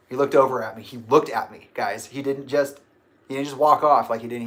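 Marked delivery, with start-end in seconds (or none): none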